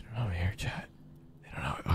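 hushed whisper to the viewers. Real, not acted. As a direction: keep it under your breath. Sneaking, whispering to chat.

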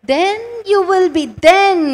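THEN pushed high and loud; stress on then. Shown as a demonstration. Preacher drilling the congregation on one word, loud. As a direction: hit the word then hard, way up. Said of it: "underline that word".

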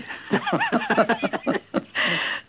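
a warm amused laugh with a falling end; hearty laugh. Acted. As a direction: laugh at the joke. Laugh after the teasing.

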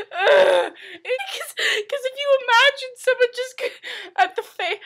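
high, loud, helpless laughing speech; talking through laughter. Real, not acted. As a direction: try to explain while cracking up. Cannot talk for laughing.

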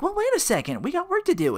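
high-pitched imitation protest; objecting coworker. Rita stopping Phil before work, high-pitched objection.

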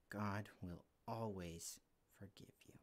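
soft reassuring whisper. Real, not acted. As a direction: breathe it as a gentle promise. Closing line of kids' sermon.

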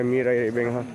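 normal talking voice

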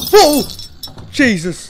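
loud high shocked whoa; startled shout. Real, not acted. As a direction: jolt at the scare. Jump scare in horror game.

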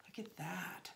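hushed and charmed, almost no voice; awed whisper. Real, not acted. Vlogger showing viewers the eye mask, hushed whisper. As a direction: whisper with delight over the gift.

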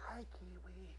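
soft unvoiced whisper; hushed greeting to a shy puppet. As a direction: breathe it so you don't scare him. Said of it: "Gotta whisper again".

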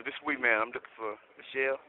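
ordinary talk, nothing marked

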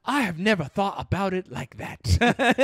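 put-on African accent, struck by it; imitated interviewer. Interviewer loses the argument, in a mock African accent. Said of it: "he's just like".

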